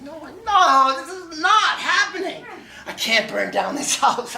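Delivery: very high, loud frantic pleading; panicked. Retold or imitated. Locked out with a burning pot, frantic high panic. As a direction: lose it completely, pitch cracking up.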